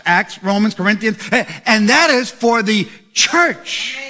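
rising, shouting preacher's cadence; preaching. Acted. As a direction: build to a roar for the church. Building preaching at full force.

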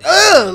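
loud, high "ugh"; disgusted groan. Real, not acted. Host finding licorice candy, loud disgusted groan.

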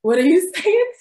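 laughing confusion. Real, not acted. Can't understand the country accent.

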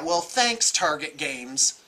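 ordinary talk

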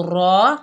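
रॉ full-mouthed; rounded, thick रॉ; heavy रॉ; heavy raw. Shown as a demonstration. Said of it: "मोटा हरूफ है".

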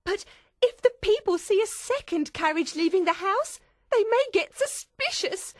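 very high, loud, fretful voice; agitated high-pitched pleading; tearful, worried. Acted. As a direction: fret urgently in a high character voice. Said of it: "Miss Pross said tearfully".

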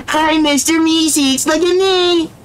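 high, drawn-out cartoon greeting; Mister Meeseeks. Voice actor bit for a friend, high cartoon impression. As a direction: squeal it in a very high character voice. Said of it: "my voice is just like his".

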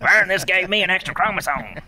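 lightly hammed-up comic character voice; Ernest. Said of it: "if you turned that character".

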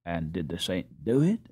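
ordinary delivery

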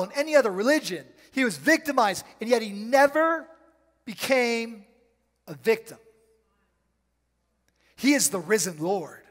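loud, high, driving sermon climax; full-force preaching. Acted. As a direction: build it up and proclaim, slowing at the end.

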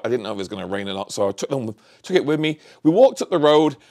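ordinary talk, nothing marked

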